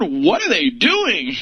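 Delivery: swooping pitch, slow and stretched; mock demo of sing-song talk that jumps all over the place; all over the board. Shown as a demonstration. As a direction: let the pitch leap wildly up and down. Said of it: "Are they talking up and down all over the board?".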